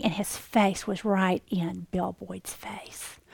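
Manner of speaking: slow, breathless whisper; awed hush. Retold or imitated. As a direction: whisper it as if the lion could hear. Lion face to face, awed hush.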